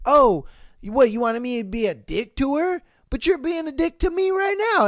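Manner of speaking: playing the cheater turning the blame; mock indignant comeback. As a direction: flip it back on her, indignant. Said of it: "he's like".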